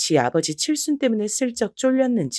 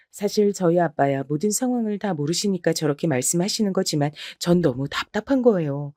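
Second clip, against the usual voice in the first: ordinary talk, nothing marked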